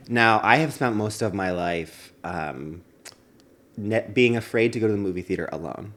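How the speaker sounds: ordinary delivery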